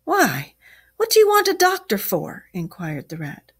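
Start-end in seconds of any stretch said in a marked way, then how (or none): 0.0-2.5 high, loud startled question; surprised. A narrator's reading. Rat questions Toad's wish for a doctor, surprised. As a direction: ask it in alarm, pitched well up.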